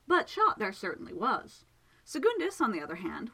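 usual speaking voice

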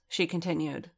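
usual speaking voice

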